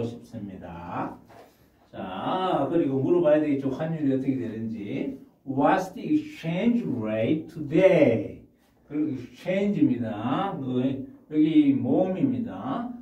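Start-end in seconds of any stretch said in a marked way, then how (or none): 5.5-8.4 very slow, clear English; slow model phrase. Shown as a demonstration. Teacher slowly modelling a question for the class. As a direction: lay each word out for learners. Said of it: "teacher models English phrase".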